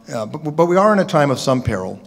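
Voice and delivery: ordinary talk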